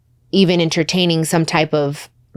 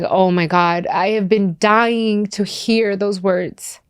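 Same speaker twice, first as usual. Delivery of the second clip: retold overwhelmed outburst, rising and slowing; losing it; 2 semitones higher, much slower than usual. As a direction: draw the words out as emotion climbs. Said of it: "Oh my God, I lost it".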